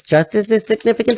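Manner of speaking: normal talking voice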